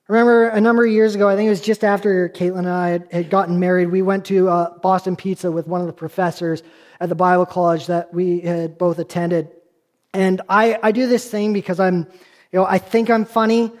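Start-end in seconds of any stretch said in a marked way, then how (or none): none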